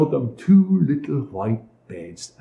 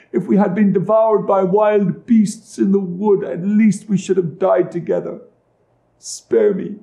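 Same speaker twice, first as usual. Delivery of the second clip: grief-stricken plea; 7 semitones higher, 3 dB louder than usual. A narrator's reading. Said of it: "Oh, what a grief".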